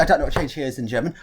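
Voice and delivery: ordinary delivery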